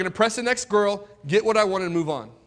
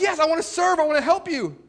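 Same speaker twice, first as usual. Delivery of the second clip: much higher, keen offer to serve; eager yes; 10 semitones higher than usual. Retold or imitated. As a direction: sound willing to please. Eager yes to every request.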